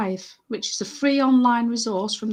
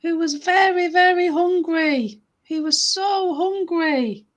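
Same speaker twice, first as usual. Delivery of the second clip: higher, drawn-out story narration; once-upon-a-time telling voice; storytelling; 4 semitones higher, 4 dB louder, slower than usual. Acted. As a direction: tell it slowly to children, big on key words. Tells story to children.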